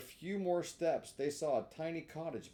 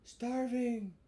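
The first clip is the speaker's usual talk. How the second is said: cat echoing; 9 semitones higher than usual. Acted. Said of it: "repeated the cat".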